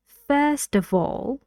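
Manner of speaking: "First" high and long, "of" nearly silent; stress on first, weak of. Shown as a demonstration. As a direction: hit "First", swallow the "of". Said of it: "این of اینجا خیلی ساکت شده".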